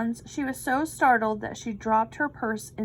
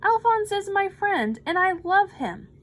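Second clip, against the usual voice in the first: pleading; 8 semitones higher, 4 dB louder than usual. A narrator's reading. Louis pleading.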